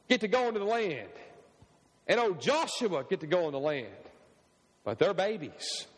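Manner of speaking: normal talking voice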